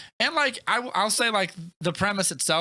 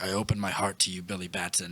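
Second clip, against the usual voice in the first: low, hushed and grave, like an ancient wizard; solemn old wizard; 6 semitones lower, 5 dB quieter than usual. Retold or imitated. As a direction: intone it softly as the dying wizard. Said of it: "and the wizard's like".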